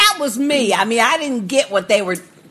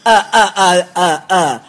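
ordinary talk

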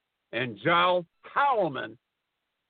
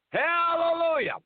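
exclaiming hallelujah; 13 semitones higher than usual. Pretend. Triumphant exclamation ending the rant.

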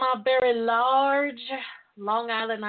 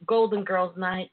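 ordinary talk, nothing marked

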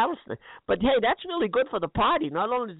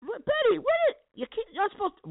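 much higher exasperated squeal; outraged squeal; 11 semitones higher than usual. Acted. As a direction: sputter in disbelief. Scolding partner for eating.

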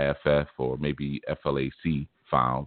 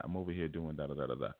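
mumbled mock reply; 12 dB quieter, faster than usual. Voicing the ranter's reply.

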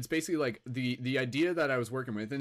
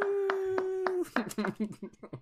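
high laugh. Acted. High falsetto laugh after boo.

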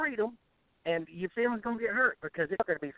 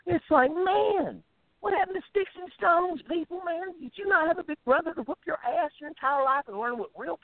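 worked-up rant; 10 semitones higher than usual. Real, not acted. Ranting about hurt feelings.

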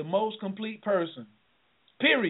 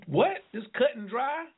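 ordinary delivery

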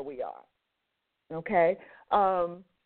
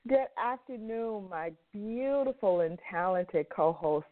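ordinary delivery

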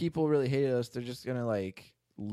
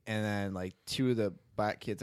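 usual speaking voice

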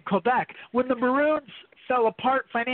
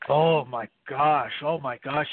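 exclamation; 8 semitones lower, slower than usual. Real, not acted. Reacting to rivalry question.

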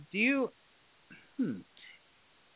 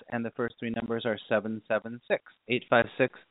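usual speaking voice

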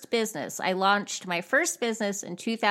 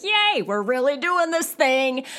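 higher, excited mock announcement; celebratory cheer; 5 semitones higher, 4 dB louder than usual. Writer announcing a finished manuscript, giddy cheer. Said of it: "be like".

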